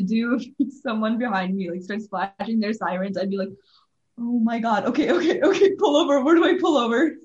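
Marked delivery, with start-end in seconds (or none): 4.2-7.1 mock panic, rising higher and louder; flustered, laughing imitation of panic; scared. Imagining police sirens behind the car, acting scared. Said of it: "I would be scared".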